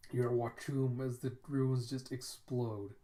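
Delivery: usual speaking voice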